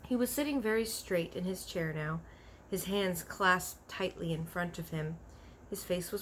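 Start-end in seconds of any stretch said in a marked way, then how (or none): none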